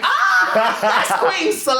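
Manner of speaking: very high excited squeal with a laugh; squealing fan. Retold or imitated. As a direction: gush it like a starstruck fan. Fan recognising the speaker.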